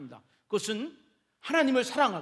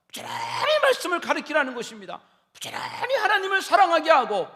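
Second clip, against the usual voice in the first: preaching shout; 6 semitones higher, 6 dB louder than usual. Acted. Preacher exhorting parents.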